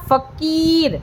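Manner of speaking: "फकीर" with "की" held long; short "फ", then draw out the ee of "की"; teacher's "फकीर" with a long second syllable; stretched ee. Shown as a demonstration. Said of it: "का को खींच के बोला".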